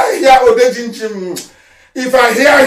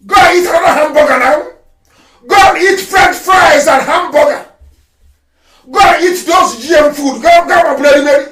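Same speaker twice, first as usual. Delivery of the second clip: loud scornful jeering; mock them for their comfort; scornful shouted taunt; 4 semitones higher, 4 dB louder than usual.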